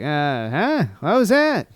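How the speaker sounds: high, loud clueless American; mock dumb American reply. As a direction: blurt it out high like a baffled tourist. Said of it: "some American stuff".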